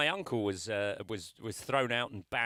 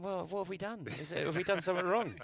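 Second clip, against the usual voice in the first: worried Americans; 7 semitones higher than usual. Retold or imitated. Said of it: "they're going".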